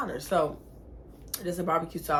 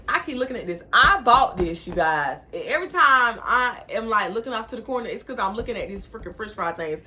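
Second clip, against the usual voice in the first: usual speaking voice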